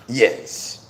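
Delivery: ordinary delivery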